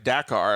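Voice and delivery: "Dakar" as DACK-ar. Retold or imitated. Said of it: "[name] was telling me".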